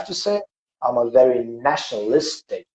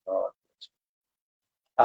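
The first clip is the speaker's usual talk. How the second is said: a very slow, choked blessing; real emotion blessing the guest; overcome with emotion; 6 semitones lower, 4 dB quieter, much slower than usual. Real, not acted. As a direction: let the feeling catch the words. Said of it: "I just got too emotional".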